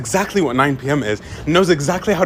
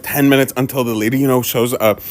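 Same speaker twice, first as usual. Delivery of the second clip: ordinary talk, nothing marked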